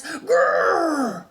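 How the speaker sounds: loud, high rolling grrr; big bear growl. Acted. The bear releasing his held breath in a loud growl. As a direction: let out the bear's enormous growl.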